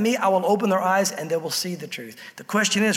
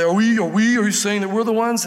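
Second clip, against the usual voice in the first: angry Pharisees, imitated. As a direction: act out affronted leaders briefly. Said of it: "I know they said it angrily".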